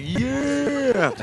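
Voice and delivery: cheering yell. Retold or imitated. Cheering from the stands.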